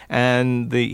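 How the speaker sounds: ordinary talk, nothing marked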